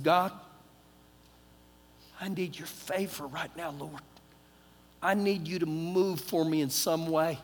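low, hushed prayer; quiet plea to God. Retold or imitated. Stuck passenger quietly praying for favor, hushed plea.

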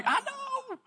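put-on high, cracked squeak of a kid; cracking young voice. A hint of a boy's cracking voice, laughing. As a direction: laugh as your voice breaks upward. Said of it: "your voice sounded like about a sixth or seventh grader".

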